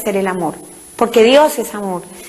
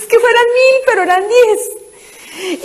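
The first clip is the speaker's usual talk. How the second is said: usual speaking voice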